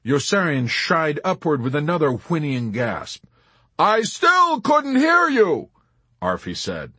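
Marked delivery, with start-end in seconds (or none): 3.8-5.7 high, slow, loud call; oblivious calling out. A narrator's reading. Arfi failing to hear Yossarian, oblivious loud call. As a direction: call it out blithely.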